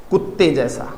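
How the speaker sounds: normal talking voice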